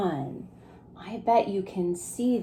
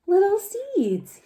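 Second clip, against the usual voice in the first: high, slow tender voice; gentle Digger; 11 semitones higher than usual. Acted. Digger names the seeds to the children, high and slow. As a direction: savor the words as Digger. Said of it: "he said".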